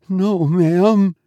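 high, faint reply; frightened maid. A narrator's reading. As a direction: answer the mistress fearfully. Terrified maid answers her mistress.

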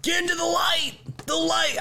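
high mocking shriek of a TV host; mock him yelling into the light; hammed-up high screech; mock screaming. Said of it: "while he's screaming".

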